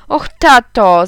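loud whiny plea to dad; pleading cry. Acted. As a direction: wail it like a sulking boy. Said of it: "zawołałem". (głośne błagalne jęczenie)

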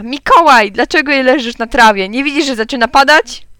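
mother calling loudly across the garden. A narrator's reading. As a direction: call him in from afar, loud and high. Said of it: "mama zawołała". (mama głośno woła z domu)

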